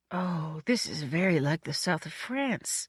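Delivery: lightly put-on genteel companion; a hint of an admiring guest; lightly voiced Lewis. A narrator's reading. Lewis admiring the avenue, lightly voiced.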